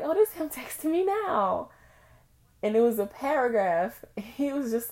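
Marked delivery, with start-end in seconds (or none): 0.0-1.7 much higher, bright eager voice; excited surprise. Retold or imitated. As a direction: perk up like his text just arrived. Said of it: "and I was like".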